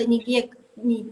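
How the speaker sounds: normal talking voice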